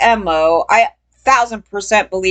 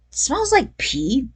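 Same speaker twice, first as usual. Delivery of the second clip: disgusted aside; 4 semitones higher, 6 dB quieter, much slower than usual. Retold or imitated. Disgusted at the smelly jacket.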